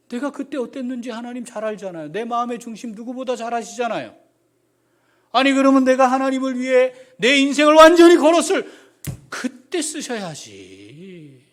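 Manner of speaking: loud, high protest that rises to a cry; indignant outcry. Acted. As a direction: argue hard with God, then a rueful laugh. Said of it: "지금 모세는 어떤 감정일까요".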